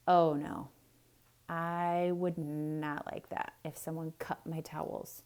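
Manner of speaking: ordinary delivery, nothing marked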